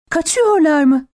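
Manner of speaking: high-pitched panicked question; horrified cry. A narrator's reading. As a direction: ask it in narrated terror. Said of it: "diye çığlık attı dehşetle".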